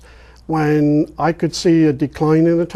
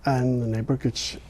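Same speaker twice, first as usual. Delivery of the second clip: ordinary talk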